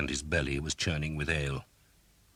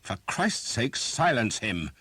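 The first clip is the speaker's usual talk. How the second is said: higher, louder snarl; snarled order; 7 semitones higher, 4 dB louder, slower than usual. Acted. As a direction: bark at your men to shut him up. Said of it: "Sir Simon snarled".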